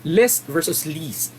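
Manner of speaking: "List" with a short i against "least" with a long ee, said slowly; short i versus long ee. Shown as a demonstration. Teacher contrasts list and least, slowly. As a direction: contrast the two vowels, unhurried. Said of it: "stress when it comes to English".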